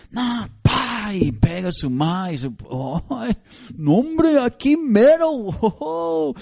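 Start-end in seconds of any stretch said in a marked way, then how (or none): 0.1-1.1 put-on higher kid's refusal; defiant child. Child refusing his mother, high and defiant. 3.1-6.3 higher, mock-shocked ay; exasperated exclaim. Onlooker reacting to a child hitting his mother, mock-shocked.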